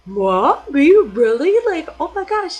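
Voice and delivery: loud, high burst of astonishment; amazed disbelief. Retold or imitated. Told she can be a singer in Korea, astonished. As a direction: blurt it out, thrilled and disbelieving.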